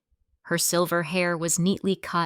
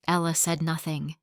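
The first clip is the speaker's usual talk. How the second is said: ordinary delivery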